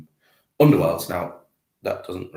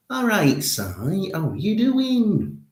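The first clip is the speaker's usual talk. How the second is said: loud, high, drawn-out Geordie greeting; Hairy Bikers; 13 semitones higher, 8 dB louder, much slower than usual. Acted. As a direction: call out in a big, slow Geordie impression. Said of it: "I will do the hairy biters".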